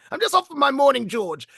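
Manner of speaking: put-on high, swooping old-boy British voice; upper-class naval boss. Imitating the old boss's phrase for the toilet, upper-class British. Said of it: "actually spoke very much like that".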